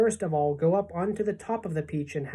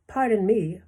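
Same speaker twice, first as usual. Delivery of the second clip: usual speaking voice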